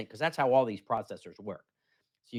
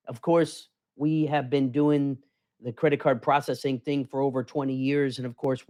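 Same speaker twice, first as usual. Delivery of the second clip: ordinary talk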